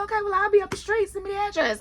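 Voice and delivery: quick, high, eager partner voice; eager boyfriend. Retold or imitated. Boyfriend insists on following her to the meeting, fast and eager. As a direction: rushes it out, insisting on coming. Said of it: "He said".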